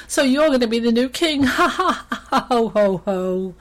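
high, loud hyena mockery through laughter; between laughs. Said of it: "between laughs he said".

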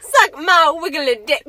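loud mock Southern twang; Southern accent. Host repeating a vine line to friends, mock Southern accent. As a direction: belt it out in hammed-up Southern. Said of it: "I'll do a Southern accent".

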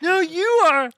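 put-on high, indignant drunk denial; town drunk. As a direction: deny it like a drunk. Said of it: "You're drunk".

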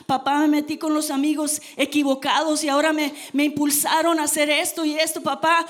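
builds from plea to loud desperate calling of papá; son calling out. Retold or imitated. Son crying out for parents.

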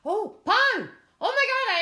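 high, loud cry of sudden recognition; excited outburst. Real, not acted. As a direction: shout it as the answer hits you. Finally remembers quiz answer.

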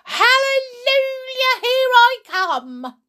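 very high, loud crowing; jubilant shout. A narrator's reading. Growing Grandma announces herself, jubilant shout. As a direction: cry it out in wild triumph. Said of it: "she shouted, finding her voice".

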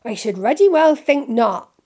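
loud growled disapproval; gruff, indignant rebuke. A narrator's reading. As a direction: growl it out forcefully. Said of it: "growled Hagrid".

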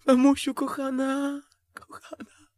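high, slow cry fading to near silence. Acted. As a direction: start high and drawn out, then die away. High cries within acted scene.

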